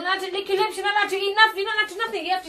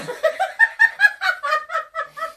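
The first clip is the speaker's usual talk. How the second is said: high cackling laugh like a witch; witch cackle; 11 semitones higher than usual. Real, not acted. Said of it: "Sound like a witch".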